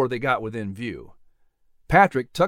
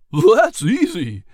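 drawn-out, louder horse voice; whinny the words, raised and stretched; excited whinny; 4 semitones higher, 5 dB louder, slower than usual. A narrator's reading. Bill finding the latch easy, excited whinnying voice. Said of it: "he whinnied with excitement".

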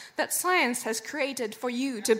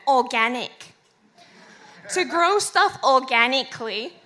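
put-on Australian Ocker accent; 6 dB louder than usual. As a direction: say organic like an Aussie Ocker. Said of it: "in which you did perfect the Australian Ocker accent".

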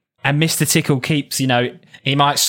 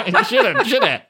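laughing. Real, not acted. Helpless laughter.

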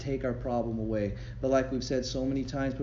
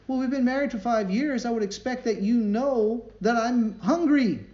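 high, louder, mock-demanding husband; 9 semitones higher, 6 dB louder than usual. Husband on the phone demanding supper, mock-indignant. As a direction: play the entitled husband calling home.

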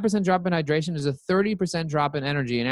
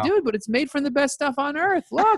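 high, whiny mock protest; mock defensive self; 11 semitones higher than usual. Arguing with his doctor about Snapple, high whiny protest. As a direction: whine it like a kid caught out.

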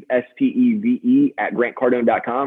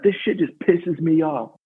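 mad boss; 6 semitones higher than usual. Retold or imitated. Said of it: "he's so mad about it".

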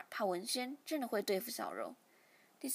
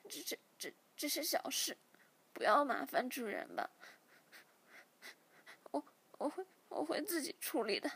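higher, halting, choked-up refusal; tearful stammering; 4 semitones higher than usual. A narrator's reading. 小喵 turns down help from her friends, tearful and halting. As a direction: stammer through held-back tears.

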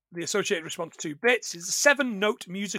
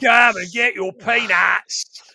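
slow, bellowed Cockney patter; rowdy drawn-out Cockney shout; Cockney music-hall call; 5 dB louder, slower than usual. Acted. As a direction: holler it like a Cockney showman. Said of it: "you know the Cockney version of".

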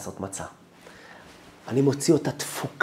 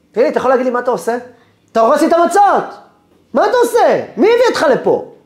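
very loud, high-pitched tirade; furious shouting; 14 semitones higher, 12 dB louder, faster than usual. Retold or imitated. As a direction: berate a worker at the top of your voice. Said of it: "ממש בצעקה". (צעקות זעם בקול גבוה)

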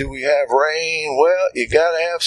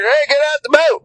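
calling out; 10 semitones higher than usual. Retold or imitated. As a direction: hail him from a distance. Jesus calling to Peter.

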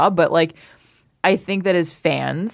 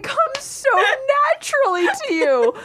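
amazed squeal. Real, not acted. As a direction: gush in high delight. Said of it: "The Australian accent is so hard to nail down".

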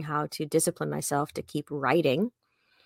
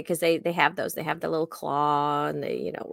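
ordinary talk

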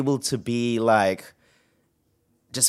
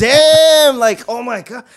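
high, loud drawn-out damn; imitating his own amazement at his friends' bravery; amazed exclamation; 14 semitones higher, 6 dB louder, slower than usual. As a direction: stretch damn out in awe. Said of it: "I'm like".

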